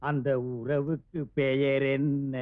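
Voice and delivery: slow coaxing question. Acted. Husband coaxing his shy new wife to answer, slow and coaxing. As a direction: draw the question out patiently.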